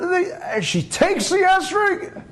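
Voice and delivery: high-pitched, heated telling; worked-up storytelling. Retold or imitated. Storyteller relating the wife grabbing the esrog, high and agitated. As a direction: tell it at a fever pitch. Narrating the wife's furious rampage.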